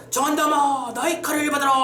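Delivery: storyteller's high-pitched battle challenge; acted thunderous shout. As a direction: call out the enemy with a heroic cry. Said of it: "병력 같은 소리를 지르며".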